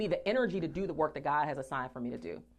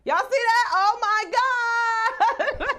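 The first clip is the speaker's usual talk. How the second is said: much higher, louder, slow emotional cry; overjoyed cry; 7 dB louder, much slower than usual. Real, not acted. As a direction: break into a tearful joyful shout. Overwhelmed by her own news.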